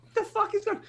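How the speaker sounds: very high, squeaky bewilderment; stunned disbelief. Retold or imitated. Player suddenly knocked off the map, high baffled squeal. Reenacting being flung off the map.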